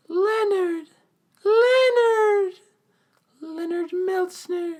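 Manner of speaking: dazed, very high-pitched mock wail; Eugene. Eugene walks into Wit's End in shock, dazed high wailing. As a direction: draw the name out high, as if in a trance. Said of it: "Eugene's just dazed".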